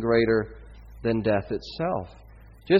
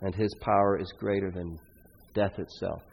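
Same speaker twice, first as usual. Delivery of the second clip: soft, lower tender words; gentle reassurance; 3 semitones lower, 8 dB quieter than usual. Acted. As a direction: hand the child back tenderly. Prophet gently returns son to mother.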